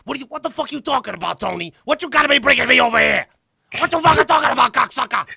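high, worked-up mobster rant; Joey Diaz. Retold or imitated. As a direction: imitate a brash comic as an angry gangster. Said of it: "full out of Joey Diaz impersonations".

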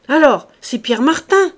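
high, very loud accusing question; angry guess. A narrator's reading. Farmer badgering servant with another name, loud and angry. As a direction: throw out the next name, insistently.

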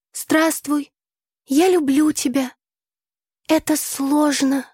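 high, slow poem recitation; expressive declamation. A narrator's reading. Tasya declaims her poem to her friend again, expressively. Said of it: "Вновь выразительно продекламировала Тася".

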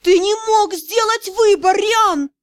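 yelling. A narrator's reading. Rejected lady screaming at the lord, furious yell. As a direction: scream his name at him. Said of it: "А после как заорёт".

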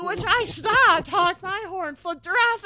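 half-laughing, fired-up retelling; excited retelling. Retold or imitated. Retelling her angry response.

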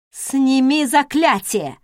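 slow, loud furious demand; angry bark. Acted. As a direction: bark the order in rage. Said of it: "рявкнула она".

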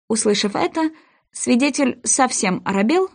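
ordinary delivery

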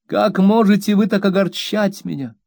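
high, lightly tearful reproach; mother near tears, high and pleading; tearful plea. A narrator's reading. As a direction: plead through mild tears. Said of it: "плача ещё горше".

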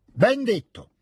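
high, hearty exclamation; exclaimed approval. A narrator's reading. Count applauds the proclamation, exclaiming high. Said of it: "esclamò il conte".

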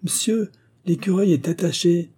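a high, teasing call down from a tree; boy calls mockingly down from the tree. A narrator's reading. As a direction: tease the gentleman from up high. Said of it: "cria d'une voix goguenarde".

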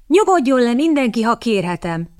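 high, courteous request dropping at the end; polite. A narrator's reading. Kitty calms the armed room, polite. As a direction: ask everyone nicely yet in control. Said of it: "tanácsolta udvariasan Kitty".

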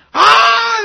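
high, loud sarcastic "Ah"; a mocking burst of fake surprise; mocking scoff. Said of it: "así burlándose sarcásticamente".

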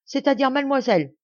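servant bursting out, stumbling over the title; excited cry. Acted. Domestique qui arrive affolé, cri agité. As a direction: call out in a flustered rush. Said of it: "s'écria le domestique".

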